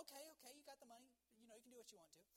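high, soft, agreeable mom voice; lightly acted, breezy motherly consent; clueless mom. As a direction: imitate an unsuspecting mother giving in easily. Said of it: "his mom was kinda out of the clue".

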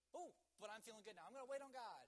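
acted burst of fresh confidence; renewed enthusiasm. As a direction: bounce back into keen resolve. Acted renewed keen resolve.

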